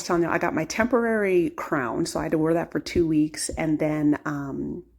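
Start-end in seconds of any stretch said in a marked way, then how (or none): none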